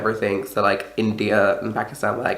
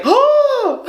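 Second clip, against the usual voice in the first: very high, loud theatrical oh; shocked gasp; 6 dB louder than usual. Retold or imitated. Mimicking a shocked woman in the queue, very high and loud. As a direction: gasp like a scandalised lady. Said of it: "she was like".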